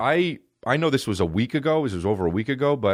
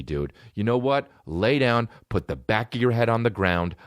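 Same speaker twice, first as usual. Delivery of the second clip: ordinary talk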